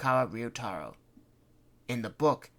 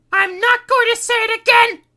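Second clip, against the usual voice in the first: much higher and louder scream; 17 dB louder than usual. Acted. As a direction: lose patience and shriek. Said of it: "she screamed".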